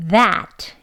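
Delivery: "that" ending in a released t; full final t. Shown as a demonstration. Teacher models "that" with final t. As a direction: sound the final t of "that". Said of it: "뒤에 오는 트까지 발음하게 되면".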